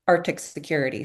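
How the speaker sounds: ordinary delivery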